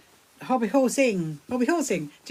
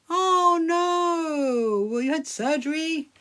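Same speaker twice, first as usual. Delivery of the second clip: high, drawn-out sympathetic oh no; higher-pitched, concerned questioning; concerned surprise; 7 semitones higher, much slower than usual. Real, not acted. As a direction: react with dismay to a friend's news. Learns viewer faces surgery.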